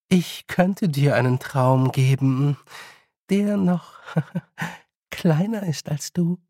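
slow, sweet queen with a small laugh; falsely friendly. Acted. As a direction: offer the threat kindly, drawing it out. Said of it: "sagte die Königin freundlich". (freundlich-drohend, genüsslich langsam)

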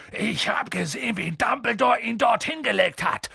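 high-pitched outraged accusation; indignant. A narrator's reading.

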